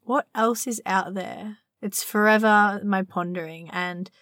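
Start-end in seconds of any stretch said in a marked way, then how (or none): none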